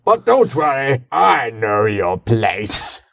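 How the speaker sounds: slow, smug villain purr; Imperator [name] voice. Acted. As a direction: savour each word like a gloating ruler. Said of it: "That's my Imperator [name] voice".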